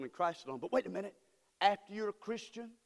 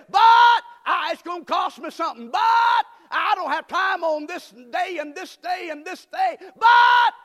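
shouted excuses, full preaching; 9 semitones higher, 18 dB louder than usual. Acted. Shouted sermon, mock excuses.

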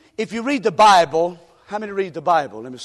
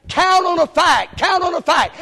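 shouted slogan; 7 semitones higher, 3 dB louder than usual. Shown as a demonstration. Preacher repeating the slogan to the church, shouting. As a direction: hammer it home.

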